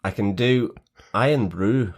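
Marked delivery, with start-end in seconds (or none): 1.1-1.9 brief stab at a Scottish accent; put-on Scottish lilt. Shown as a demonstration. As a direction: have a go at Scottish, just two words. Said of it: "we can try a Scottish accent as well".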